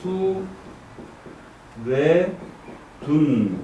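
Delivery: held syllables with long pauses, stepping down. Shown as a demonstration. Teacher repeating the hexagram reading for students, syllable by syllable. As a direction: space out each syllable, descending. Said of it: "이렇게 읽는 거예요".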